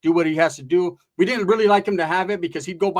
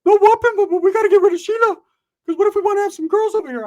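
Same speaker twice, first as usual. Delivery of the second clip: high, loud, whiny cousin voice; pitched-up loud buddy complaining about his girl; Ricky's voice; 7 dB louder than usual. Retold or imitated. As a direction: squeak it out like a worried goofball. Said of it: "He's like".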